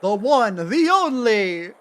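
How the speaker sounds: booming showman introduction; grand announcer. Acted. Reading out the magician's title in a booming showman voice. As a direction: build it up like a circus ringmaster.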